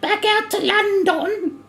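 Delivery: squeaky mock Brit rattling off pub food; British voice. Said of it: "you can still do the British voice".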